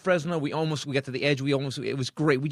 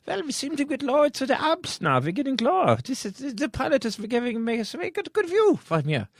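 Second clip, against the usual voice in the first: put-on much higher, relaxed European accent; a calm European tourist admiring the view; chill European passenger; 11 semitones higher than usual. A calm European passenger in a hijacked plane, relaxed accent. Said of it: "Europeans are much chiller".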